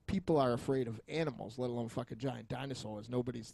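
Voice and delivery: ordinary delivery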